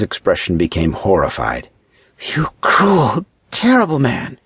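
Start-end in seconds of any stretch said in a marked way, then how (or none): 2.3-4.4 high-pitched, venomous accusation; hissed anger. A narrator's reading. Horrified woman to the man claiming to be Paul, hissing. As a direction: spit it at him, pitched high. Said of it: "she hissed as she glared at him".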